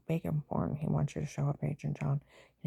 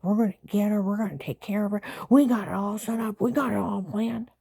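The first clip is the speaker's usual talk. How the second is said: loud, higher mock boast of the plotters; mocking the gang; 4 semitones higher, 6 dB louder than usual. Imitating the neighbors plotting, loud and mocking. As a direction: ham up their cocky scheming. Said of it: "they all act like".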